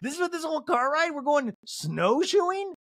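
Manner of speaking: high-pitched incredulous question, stretching the last word; mock disbelief. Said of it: "I was like, "Wait, what?".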